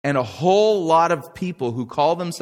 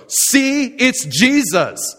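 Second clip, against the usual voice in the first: much higher, louder, slowed-down gasp of wonder; awed crowd; 13 semitones higher, 8 dB louder, much slower than usual. Acted. The crowd hails the fake Jesus, awed and loud. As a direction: hail it like a dazzled believer.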